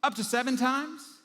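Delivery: high, loud, drawn-out rising question; proud zinger. Acted. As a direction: throw it out like a winning answer. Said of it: "he throws out what he was like, a zinger".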